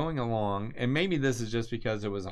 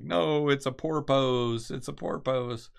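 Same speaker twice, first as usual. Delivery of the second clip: put-on higher, slow-starting yell in a rough Greenland accent; mock Icelandic accent; 6 semitones higher than usual. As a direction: call back with a lightly imitated Icelandic accent. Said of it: "yelling back in this Icelandic or this Greenland accent".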